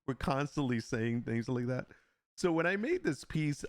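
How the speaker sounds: ordinary talk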